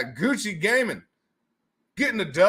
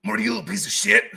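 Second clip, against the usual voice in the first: fast, slurred mad-scientist rant; Rick impression; 4 semitones higher, much faster than usual. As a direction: snap at Morty like Rick. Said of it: "Oh, jeez, Rick".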